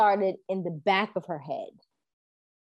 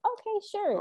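breezy agreeing; 13 semitones higher, 4 dB quieter than usual. Retold or imitated. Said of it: "I was like".

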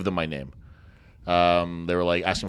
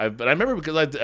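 ordinary talk

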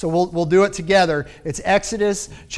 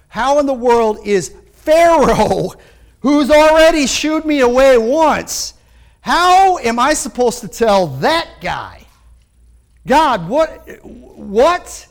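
Moses protesting; 3 semitones higher, 5 dB louder, slower than usual. Retold or imitated.

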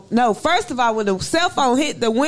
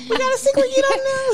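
high, put-on taunting voice; teasing adamant impression; 14 semitones higher than usual. Teasing a friend about Trevor and the secret, high mocking impression. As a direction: mimic him, pitched way up. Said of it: "And he be adamant".

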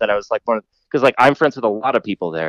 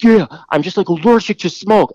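mock excuse voice; 8 semitones higher than usual. Imitating the woman's excuse.

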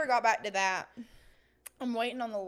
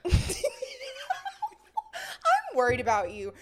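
laughing; 3 dB louder, faster than usual. Real, not acted. Laughing at co-host.

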